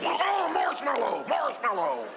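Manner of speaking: high, slow wailing plea; distressed wail. Retold or imitated. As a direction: wail the safe word while being crushed. Muffled cry for help under bear.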